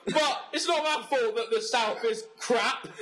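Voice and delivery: put-on botched accent that lands as American Southern; mock Southern accent. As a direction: argue back in a badly faked Southern accent. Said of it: "You sound like a Southern general".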